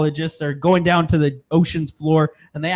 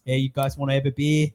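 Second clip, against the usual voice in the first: mock Australian accent; 4 semitones lower, 4 dB quieter than usual. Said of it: "like, an Australian accent".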